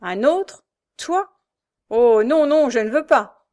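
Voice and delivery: frightened refusal. A narrator's reading. Pierre refusing his brother's scheme, frightened and quick. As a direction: recoil in fear as you refuse. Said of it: "dit vivement Pierre".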